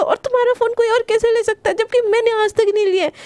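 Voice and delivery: high, fast jealous rant; angry outburst. A narrator's reading. Priyanka scolding Garima on the phone, jealous and angry. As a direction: unload it all in one breath. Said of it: "एकदम गुस्से में बोलती है".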